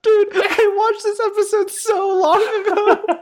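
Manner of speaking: high, whiny complaining. Pretend. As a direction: whine it in a high pitch.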